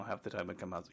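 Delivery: normal talking voice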